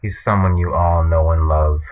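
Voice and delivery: usual speaking voice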